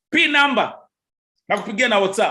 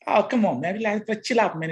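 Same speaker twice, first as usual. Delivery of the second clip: ordinary delivery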